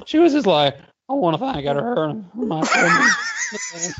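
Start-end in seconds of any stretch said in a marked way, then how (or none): 1.1-3.9 higher, slow giggling thank-you speech; mock nervous speech. Mocking Katie's shaky speech at the presser, giggling and high. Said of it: "reenactment of Katie's speech".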